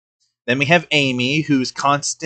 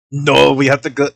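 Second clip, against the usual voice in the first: lightly voiced Terminator, louder; a light Arnold impression, barking orders; mock Terminator voice; 6 dB louder than usual. Reacting to a cartoon, doing a light Terminator voice. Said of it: "that's just her voice".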